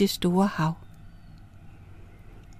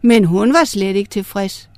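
plain reading voice

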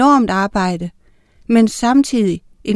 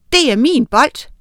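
raised, slightly louder claim; insistent; 4 semitones higher, 4 dB louder than usual. A narrator's reading. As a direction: insist on it, a little higher.

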